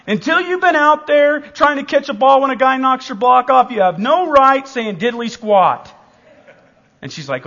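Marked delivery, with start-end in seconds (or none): 0.1-5.9 son scolding mom. Retold or imitated.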